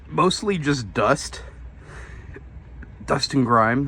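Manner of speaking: talking through hiccups; hiccuping between words. Real, not acted. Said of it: "I got the hiccups out of nowhere".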